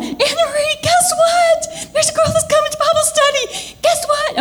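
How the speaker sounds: high, breathless guess what; bursting to tell a friend the news; phoning a friend, high and thrilled; excited phone news. Retold or imitated. Excited calls spreading news.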